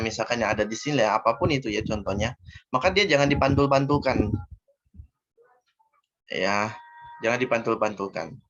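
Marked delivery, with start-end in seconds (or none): none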